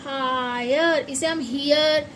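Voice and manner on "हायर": हायर drawn out very slowly, haa-yer; very slow higher. Shown as a demonstration. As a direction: stretch हायर slowly for the class. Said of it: "ऐसे नहीं पढ़ सकते".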